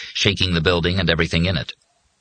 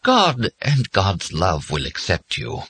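slow, distant, tender padre; gentle, dreamy benediction; faraway, gentle; slower than usual. A narrator's reading. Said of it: "in a faraway, gentle voice".

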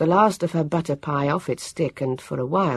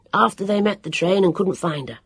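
usual speaking voice

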